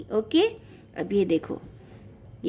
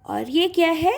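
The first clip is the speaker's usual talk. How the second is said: ordinary talk, nothing marked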